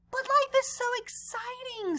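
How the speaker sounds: put-on high, eager kid voice; excited young shark. Clark telling his teacher how fun life is, high and eager. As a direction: bubble over like a thrilled kid.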